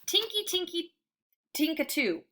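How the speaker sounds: high, sing-song chime of an ice cream truck; tinkling music sound. A narrator's reading. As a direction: lilt it like a tinkly jingle. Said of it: "a strange sound makes us all stop".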